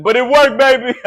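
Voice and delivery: high, loud triumphant cheer; real elation. Real, not acted. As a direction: celebrate it at full voice. Celebrates the successful test.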